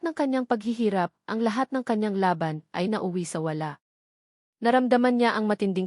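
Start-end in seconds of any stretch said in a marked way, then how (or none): none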